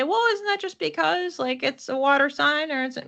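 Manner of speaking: a hint of a doubtful listener, voice pitched high; lightly mock a skeptic's objection; imagined skeptic. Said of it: "And you might say".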